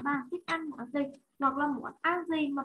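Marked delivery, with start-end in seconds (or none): none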